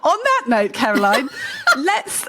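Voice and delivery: loud, high-pitched laughing segue. Real, not acted. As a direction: laugh your way into wrapping up, loudly. Laughing while moving to wrap up.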